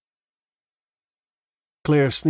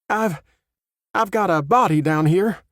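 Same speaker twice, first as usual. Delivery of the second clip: shaky voice; 8 semitones higher, 4 dB louder than usual. Acted. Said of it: "Her voice was shaky".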